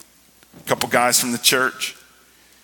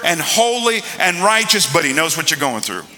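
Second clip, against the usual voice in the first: rising, impassioned preacher voice; preaching; 7 semitones higher, 4 dB louder than usual. Real, not acted. Preacher declaring Jesus the sinless sacrifice, preaching fervently. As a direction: build into high-pitched, driving proclamation.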